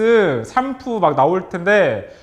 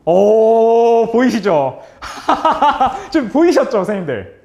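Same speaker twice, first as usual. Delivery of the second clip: excited reveal; 4 dB louder, slower than usual. Real, not acted. Reveal of the hidden answer.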